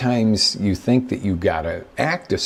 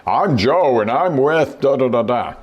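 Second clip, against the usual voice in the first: a hammed-up canned sales intro, higher and louder; pushy salesman pitch; 6 semitones higher, 4 dB louder than usual. The speaker mocks a pushy salesman giving his canned intro, hammed-up. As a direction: rattle it off like a cheesy salesman walking in.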